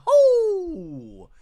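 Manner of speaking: mock pop-star whoop.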